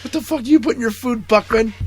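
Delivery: high, fast furious demand; hammed-up officer yelling at the cook; angry yelling. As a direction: bark the question at the cook. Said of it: "It's like".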